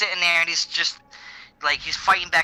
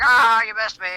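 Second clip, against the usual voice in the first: mock Boba Fett taunt; 6 semitones higher, 4 dB louder than usual. Mocking Boba Fett quote.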